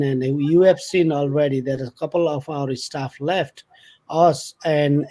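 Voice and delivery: normal talking voice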